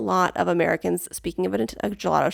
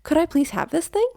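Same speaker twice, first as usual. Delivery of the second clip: much higher, gentle polite request; tentative, courteous question; polite asking; 8 semitones higher than usual. Shown as a demonstration. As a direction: ask meekly and sweetly, voice lifted. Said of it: "the way I speak it at least is like".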